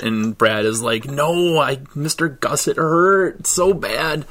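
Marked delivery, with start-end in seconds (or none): 1.1-4.3 hammed-up whimper, a little higher, stretched out; milk the pain so the teacher gives in; fake pained voice. Boy pleading with Mister Gus, mock pained whine. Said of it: "his best fake, um, pained voice".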